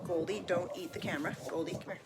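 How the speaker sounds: hushed, off-mic scolding of the dog; soft real-life interruption; quiet aside to a pet. Real, not acted. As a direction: murmur a quick aside to the pet. Aside scolding a pet mid-reading.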